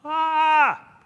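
high, loud mock scream of fright; disciples' startled shout at a ghost; frightened cry. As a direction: cry out like you see a ghost. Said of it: "And they call out".

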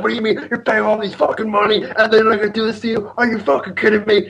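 high-pitched imitation of an incensed dad; retold fatherly outrage; outraged dad. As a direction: rant in mock disbelief, voice up high. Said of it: "just throws fuel on the fire".

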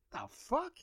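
high startled "the fuck?"; incredulous squeak. Retold or imitated. Reacting to a date's demand with a squeaky high "the fuck?". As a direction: squeak it out in disbelief.

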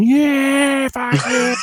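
very high, whooping mock cheer; hammed-up celebratory yell; imitated Aussie cheering. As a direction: cheer like a rowdy crowd of Aussies. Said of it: "all these Aussies just going".

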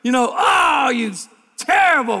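high, loud cheering and yelling at the TV; shouting fan at the game; football fan shouting. Retold or imitated. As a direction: scream like your team just scored. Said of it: "you're like".

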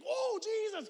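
a nervous man caught off guard; startled Peter. Retold or imitated. Peter startled when Jesus suddenly appears, high yelp. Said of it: "And Peter's like".

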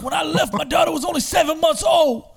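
high, loud impassioned yell; ex-prisoner yelling his story to a room of kids; retold shout. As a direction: shout it like a man who lost twenty years. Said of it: "He's like".

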